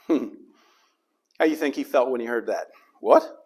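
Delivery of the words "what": a sudden high, startled "What?". Acted. Zacchaeus hearing Jesus call his name, startled. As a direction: jump up in pitch, caught off guard.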